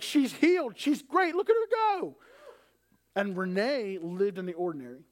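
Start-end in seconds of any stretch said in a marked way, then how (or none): 0.0-2.2 high, loud celebrating; exultant. Acted. Father imagining his daughter instantly healed, exultant. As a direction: crow it out, rising higher to the end.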